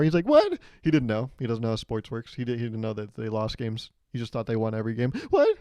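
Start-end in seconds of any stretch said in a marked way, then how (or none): 0.3-0.6 high, loud shocked yelp; shrill-pitched mock scream of disbelief; screaming kid. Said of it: "he starts screaming even more". 5.3-5.6 high, loud mock-kid shriek; imitated scream of shocked disbelief. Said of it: "he starts screaming even more".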